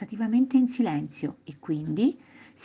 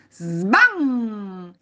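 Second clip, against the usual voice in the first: loud sbam with humming tail; door-slam imitation; 4 semitones higher, 6 dB louder than usual. Boy banging the door, loud imitated slam. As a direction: slam the word, let it resonate. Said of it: "involontariamente sbatté la porta".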